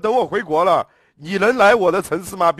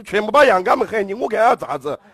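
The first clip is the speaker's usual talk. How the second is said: normal talking voice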